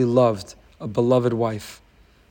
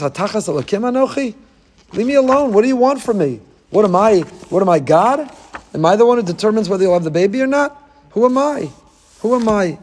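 outburst; 6 semitones higher than usual. Retold or imitated. Yaakov lashing out at Rachel in a raised, exasperated voice. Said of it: "Yaakov explodes".

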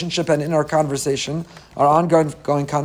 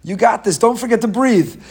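higher-pitched mock yell of encouragement; retold encouraging yell; 6 semitones higher, 3 dB louder than usual. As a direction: imitate someone cheering on strangers, without fully shouting. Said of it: "just yelling words of encouragement to strangers".

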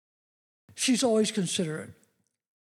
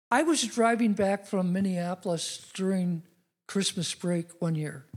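usual speaking voice

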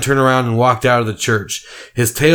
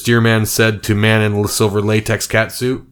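plain reading voice

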